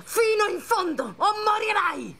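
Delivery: high, loud threatening yell; threatening shout. Acted. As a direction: scream the threat at him. Gunpoint threat, forcing confession.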